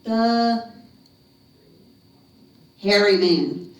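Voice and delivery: very slow, stretched words before the name; drawn-out monster announcement; dramatic reveal. A narrator's reading. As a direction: stretch it out, then land the name loudly.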